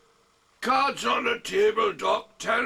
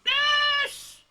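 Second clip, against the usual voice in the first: calling out. Retold or imitated. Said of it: "calling out".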